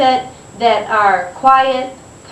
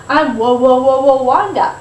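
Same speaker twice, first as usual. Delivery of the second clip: playfully mimicking the old teasing, louder; playful echo; 2 semitones higher, 5 dB louder than usual. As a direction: throw the old taunt back with a wink. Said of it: "She goes".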